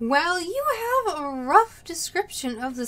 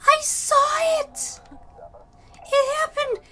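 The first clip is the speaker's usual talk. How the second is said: excited witness; 13 semitones higher, 5 dB louder, slower than usual. Acted.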